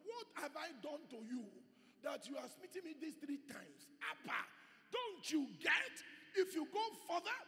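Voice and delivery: lightly voiced donkey, rising to a shout; lightly acted donkey warning Balaam; donkey pleading. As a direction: plead then warn as the donkey. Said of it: "she said to Balaam".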